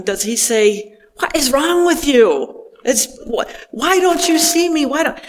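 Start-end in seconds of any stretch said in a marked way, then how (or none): 1.2-5.2 higher, scolding what-is-wrong-with-you; exasperated scolding. Pretend. Jesus imagined scolding frightened disciples, exasperated. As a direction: scold them for not seeing. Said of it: "I hear those words spoken with great compassion ... not criticism".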